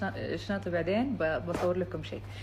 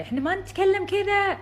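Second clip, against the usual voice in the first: تقليد عتاب ساخر; 13 semitones higher, 4 dB louder than usual. Retold or imitated. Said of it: "اهل البحرين طبعًا بيعصبون علي".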